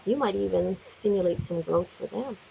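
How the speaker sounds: ordinary talk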